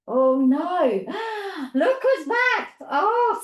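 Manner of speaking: loud, high pretend fright with a gasp; mock alarm. Teacher warning that the snake is back, hammed-up alarm. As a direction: play up the shock for children.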